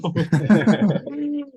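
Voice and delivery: high, loud embarrassed giggle; nervous laugh. Real, not acted. Panelist stalling on a hard question, nervous giggle. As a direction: laugh to buy time before answering. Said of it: "No pressure".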